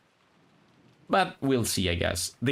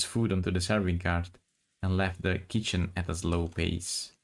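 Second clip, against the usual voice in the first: story narration. Acted. Reads the novel aloud.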